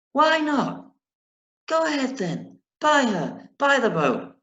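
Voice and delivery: high, teasing mother's voice; mother's reply. Acted. Mother teasing the father about the boat, high and playful. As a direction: lift up high for the smiling mother.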